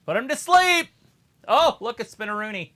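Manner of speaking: high, loud cheering yell; excited shout. Real, not acted. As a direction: shout it at the screen. Cheering a wrestling move.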